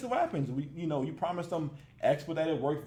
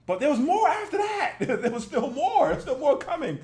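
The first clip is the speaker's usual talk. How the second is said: laughing; 7 semitones higher, 4 dB louder than usual. Real, not acted. Laughing at more footage.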